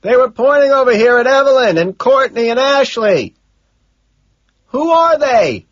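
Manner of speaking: high, sustained yelling; loud, high-pitched holler; shouting. A narrator's reading. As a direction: shout the question across a noisy crowd. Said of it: "I shout".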